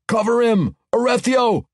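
high, loud cries from the wall; yelling. Acted. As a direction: yell after him. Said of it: "dwarves yelled".

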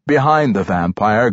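ordinary delivery

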